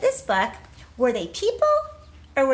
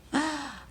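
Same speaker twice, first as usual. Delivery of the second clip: gasp; 5 semitones higher, 3 dB quieter than usual. Acted. Teacher reacting to story.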